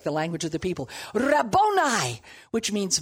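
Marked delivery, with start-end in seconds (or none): 1.1-2.2 crying out. Acted. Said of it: "cried out in Aramaic".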